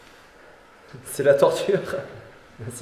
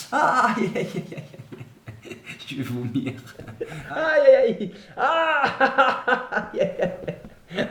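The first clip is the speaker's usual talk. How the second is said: laughing through pain; groaning aïe between laughs; pained laughing groans; 8 semitones higher, much slower than usual. Real, not acted. Painful massage, laughing in pain.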